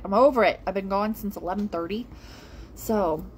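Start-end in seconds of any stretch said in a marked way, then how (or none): 0.0-0.6 louder repeat. Real, not acted.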